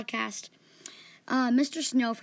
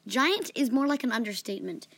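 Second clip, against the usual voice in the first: usual speaking voice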